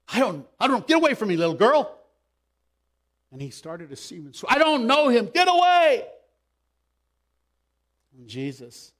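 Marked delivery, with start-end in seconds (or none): 0.1-2.0 loud, raised-pitch brush-off; flustered denial. Acted. Acts Peter denying to a girl. 4.5-6.1 high, forceful yell; shouted denial. Acted. Acts Peter's last, angry denial.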